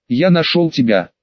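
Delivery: plain reading voice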